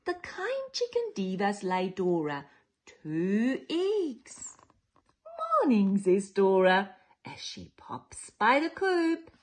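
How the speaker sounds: lively picture-book storytelling; reading to children. A narrator's reading. Storyteller reading to children, lively and sing-song. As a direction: read it like bedtime story time for little ones.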